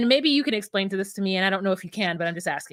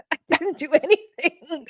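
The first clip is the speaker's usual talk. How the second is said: laughing protest; 3 semitones higher than usual. Real, not acted. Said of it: "victim blame".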